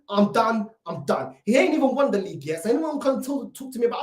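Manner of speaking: ordinary talk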